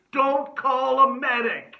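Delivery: loud, high comic shout; mock alarm. Host warning listeners about his scab, mock alarm.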